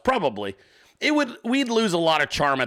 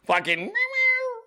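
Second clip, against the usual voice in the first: high, slow squeaky meows; mock cat meowing; slower than usual. Streamer imitating the cat begging to be pet, slow high meows. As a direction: stretch out a high cat meow. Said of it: "so he was just, like".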